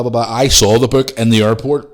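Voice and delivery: ordinary delivery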